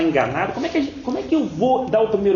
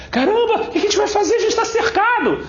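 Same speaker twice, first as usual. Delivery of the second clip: much higher, frantic mock panic; hammed-up panic, pitched way up; panicked servant; 13 semitones higher than usual. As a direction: voice the prophet's servant in alarm. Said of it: "o ajudante do profeta".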